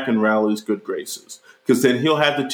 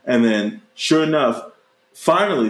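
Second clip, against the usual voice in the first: ordinary delivery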